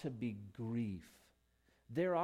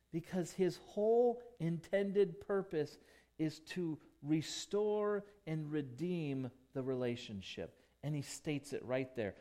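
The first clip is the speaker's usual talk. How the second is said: usual speaking voice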